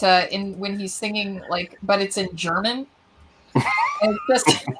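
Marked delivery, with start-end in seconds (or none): 3.5-4.7 laughing squeal. Real, not acted.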